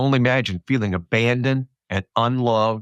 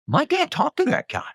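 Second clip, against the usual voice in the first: put-on much higher, boyish proud voice; imitating his sons. Future son boasting to friends that dad met him, high kid voice. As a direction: play a kid bragging about his dad, pitched way up. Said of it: "my sons are gonna go".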